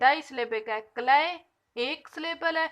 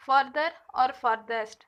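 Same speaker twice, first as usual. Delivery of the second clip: slow and clear; 2 semitones lower, 3 dB louder, much slower than usual. Shown as a demonstration. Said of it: "teacher spelling out forms".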